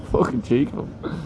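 ordinary delivery

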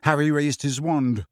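ordinary delivery, nothing marked

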